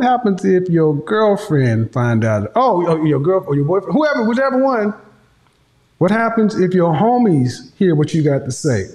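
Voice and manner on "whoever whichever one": usual speaking voice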